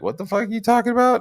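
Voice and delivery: fast, high-pitched retold disbelief; mock incredulous. Woman answering the psychopath's come-on, mock incredulous. She reacts to creepy proposition.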